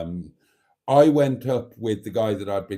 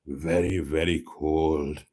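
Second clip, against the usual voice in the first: slow, low mock Welsh lilt; 8 semitones lower, slower than usual. Vlogger mimics Sean's voice note in a Welsh accent. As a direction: drag out his own words in a Welsh accent. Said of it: "very cold, very, very cold, and I mean Welsh cold".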